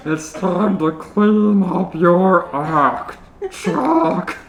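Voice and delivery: slow menacing tease, rising high at the end; villain taunt. Acted. Panty closing in on Chuck, villain taunt. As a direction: savor the threat like a villain.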